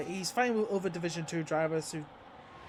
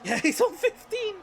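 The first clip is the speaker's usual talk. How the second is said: laughing in disbelief, pitched up; laughing commentator; 13 semitones higher, 7 dB louder than usual. Real, not acted. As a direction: crack up mid-call. Incredulous commentary on penalties.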